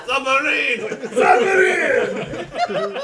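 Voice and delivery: high, drawn-out full shout; yelled. Acted. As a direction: yell it loud and high, stretching the word. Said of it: "you yell".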